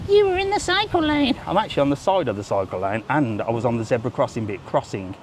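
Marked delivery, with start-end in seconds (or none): 0.1-1.4 high, whiny mock troll voice; squeaky pitched-up troll taunt. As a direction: sneer it like an online troll. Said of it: "before the trolls come on".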